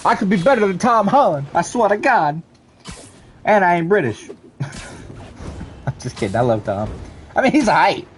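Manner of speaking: ordinary talk, nothing marked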